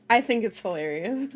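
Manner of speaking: breaking into a laugh at the end; amused, words tipping into giggles. Real, not acted. Reader finds the contextless sentence hilarious, laughing.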